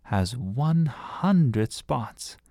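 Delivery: very slow, weighty pronouncement; a girl telling the adults the count; proud announcement. Acted. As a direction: draw out each word like a record result. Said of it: "she announced".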